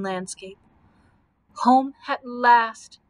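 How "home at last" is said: loud, drawn-out cry of triumph; exult, stretching each word out loudly; exultant. A narrator's reading. Scarecrow seeing Munchkin country below, exulting. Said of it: "exulted the Scarecrow".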